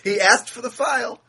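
high-pitched, laughing through the words. Real, not acted. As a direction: squeeze it out mid-laugh.

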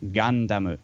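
Gandamu pronounced in Japanese syllables; Japanese pronunciation. Shown as a demonstration. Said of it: "we'll hear it pronounced like that".

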